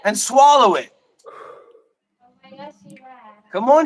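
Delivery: loud, high, jeering commands, pushing him on; taunting shout. Real, not acted. Streamer yelling dares at Shiva over stream, loud and jeering. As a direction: bark crude dares to hype him up.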